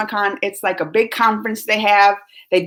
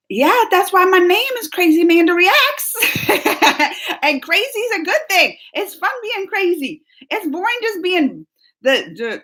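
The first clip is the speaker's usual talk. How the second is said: high, excited burst with laughter; gleeful excitement; 8 semitones higher than usual. Real, not acted. Vlogger embracing being called crazy, gleefully excited. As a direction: own it proudly, pitched way up.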